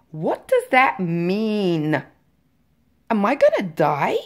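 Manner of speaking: loud, drawn-out furious demand; retold fury. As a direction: re-enact storming in and demanding answers. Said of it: "like, when hell has no fury".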